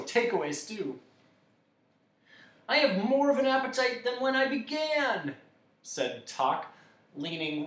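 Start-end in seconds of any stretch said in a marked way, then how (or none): 2.7-5.4 high, loud character voice; hungry dog character. A narrator's reading. Tok hungrier after eating, high and dismayed.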